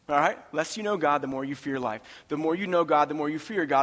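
normal talking voice

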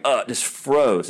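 ordinary talk, nothing marked

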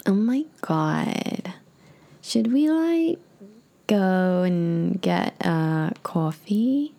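put-on very slow California girl, rising at the end; drawn-out California girl chat; California girl accent. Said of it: "accents always work better".

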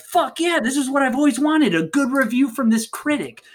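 high, excited acted triumph; gleeful mock exultation; elated. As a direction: celebrate a long-awaited win in a high voice. Director thrilled at good review.

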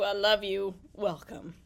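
normal talking voice

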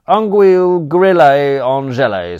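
loud, hammed-up French menu name; mock French. Butler Crawshaw presenting the entree in hammed-up French. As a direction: announce the dish like a posh waiter.

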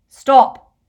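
high, very loud shout; shouted command. A narrator's reading. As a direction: halt an attacker with one word. Riddle halts an armed attacker.

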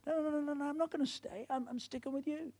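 high, soft, insistent acted protest; insistent refusal. Loyal servant refusing to leave his master, insistent. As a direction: refuse in a high, hushed rush of noes.

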